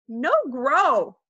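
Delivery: put-on toddler refusal. A narrator's reading. Fudge answering his mother, defiant toddler voice. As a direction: refuse like a stubborn little child.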